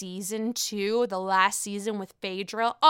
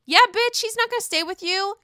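sassy comeback; 9 semitones higher, 4 dB louder than usual. Real, not acted. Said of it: "talking back at the girlfriend".

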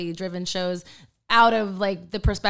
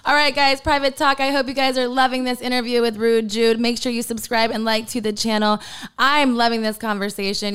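louder, higher upbeat channel promo; host plug; 4 semitones higher, 5 dB louder than usual. Acted. The host turns to viewers to plug the channel, loud and upbeat. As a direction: sell it like a YouTube host.

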